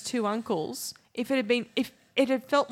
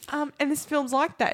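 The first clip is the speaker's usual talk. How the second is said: usual speaking voice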